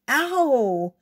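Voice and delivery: high, loud "ow" of pain; sudden pained cry; pained yelp. A narrator's reading. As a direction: yelp as if bitten on the hand. Boy shaken by dog's bite.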